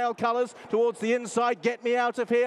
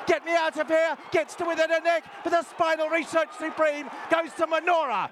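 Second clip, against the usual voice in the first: rising excitement; 4 semitones higher than usual. Real, not acted. Race caller calling the finish to the crowd, building to a shout. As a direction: call them home as the race peaks.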